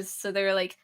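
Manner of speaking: ordinary talk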